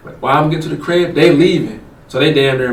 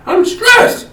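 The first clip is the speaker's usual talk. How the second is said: acted high-pitched exasperation; exasperated protest. Man denying cheating to his girlfriend, high exasperated protest. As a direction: jump way up in pitch, mock-pleading.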